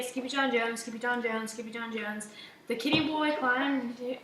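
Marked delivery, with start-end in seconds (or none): 0.0-2.3 name rattled off three times fast; rapid-fire. A narrator's reading. Narrator shows how quick the kitty is, rapid-fire. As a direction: race through the repeated name, trailing softer. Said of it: "Quicker than you can say".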